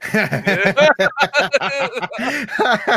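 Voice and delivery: big high burst of laughter; loud laughter. Real, not acted. Laughing at own joke.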